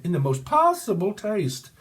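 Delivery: high-pitched comedy catchphrase voice; Kenny Everett. Retold or imitated. Quoting a comedian's catchphrase, high mock voice. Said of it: "Kenny Everett".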